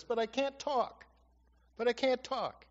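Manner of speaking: high-pitched retold protest, falling at the end. Moses objecting to God that he can't talk, protesting.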